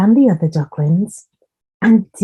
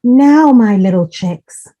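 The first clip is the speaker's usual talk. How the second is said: louder, raised motherly call; calling chicks; 3 semitones higher, 6 dB louder than usual. A narrator's reading. Mother Duck calling her ducklings together, louder call. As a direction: call the little ones to gather. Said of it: "said Mother Duck".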